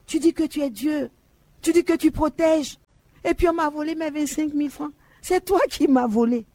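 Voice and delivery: high-pitched, indignant complaint to God; angry reproach. Retold or imitated. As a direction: accuse God, voice high and hurt.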